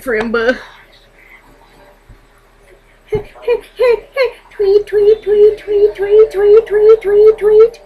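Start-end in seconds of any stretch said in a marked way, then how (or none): 3.1-7.8 high, loud chirping "tweet"; bird chirp imitation. Vlogger playing around for her viewers, chirping "tweet" high and loud.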